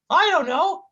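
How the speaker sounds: high goofy cartoon whine. Sidekick whining at his mean partner, high goofy voice. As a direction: plead like a hurt buffoon.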